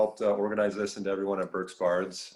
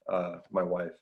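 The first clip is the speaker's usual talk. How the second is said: usual speaking voice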